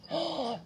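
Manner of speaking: quick, soft gasp of pretend shock; mock gasp. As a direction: suck in a breath for a listening child.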